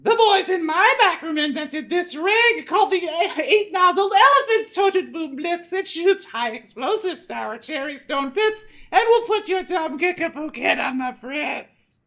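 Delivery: high, loud sneering villain voice; gloating villain. Acted. Vanitch taunting the boy about his new weapon, high sneering boast. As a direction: crow it like a smug old rival. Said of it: "the old pig".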